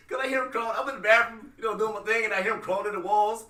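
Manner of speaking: usual speaking voice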